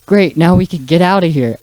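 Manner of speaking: lightly acted triumphant line; Shaggy. Retelling Shaggy's escape at the punchline, loud mock relief. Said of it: "or Shaggy goes".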